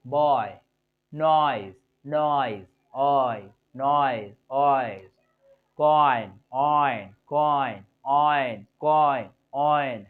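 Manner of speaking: "ऑइ" said loud with a falling glide in each word; pronunciation drill. Shown as a demonstration. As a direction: drill बॉय, नॉइज, कॉइन one by one, loudly. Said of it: "हम कैसे पढ़ रहे हैं".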